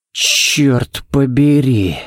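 drawn-out, breathed-out oath; slow exhale of disbelief; exhaled curse. A narrator's reading. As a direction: let the curse out slowly on a long breath. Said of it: "выдохнул Балок".

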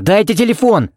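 high urgent shout for the phone; shout, demanding the phone; loud demand. A narrator's reading. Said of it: "крикнул он неожиданно громко". (громкое требование)

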